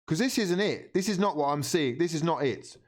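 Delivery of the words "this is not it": ordinary talk, nothing marked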